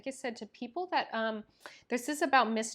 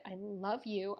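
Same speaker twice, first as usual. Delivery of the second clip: ordinary talk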